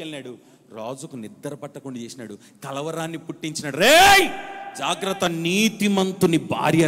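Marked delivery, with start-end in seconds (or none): 3.8-6.9 God's warning shout. Acted. God shouting a warning at the king in his dream. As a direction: bellow it to stop him.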